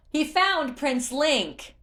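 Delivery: high, loud and slow called reply; calling up. Acted. Tula calls up to Groove on the tower, loud. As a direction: shout up to the tower.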